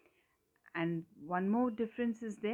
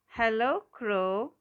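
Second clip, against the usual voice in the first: raised greeting, slightly drawn out; mild storybook shout; 3 semitones higher, 5 dB louder, slower than usual. A narrator's reading. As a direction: call up to a passing bird. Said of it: "shouted Toad".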